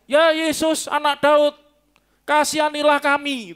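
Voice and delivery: high, loud cry for mercy; shouting beggar. Acted. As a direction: shout it like a blind man calling after help. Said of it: "Tuhan teriak-teriak".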